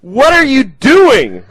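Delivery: high, loud, drawn-out disbelief; God's outraged shout. Acted. God stopping Abraham from killing his son, outraged shout. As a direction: holler it in appalled astonishment. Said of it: "and God goes".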